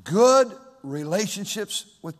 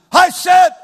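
put-on loud, high snapping shout at a child; angry parent shout; 10 semitones higher, 14 dB louder than usual. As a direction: bark it like an impatient father. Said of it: "it's not like this".

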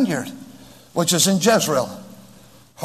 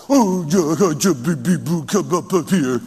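hammed-up cooing to an infant; mock baby talk; 2 semitones lower than usual. Parent coaxing a baby up, mock baby talk. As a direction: coo in sing-song nonsense. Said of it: "don't talk baby talk to your children".

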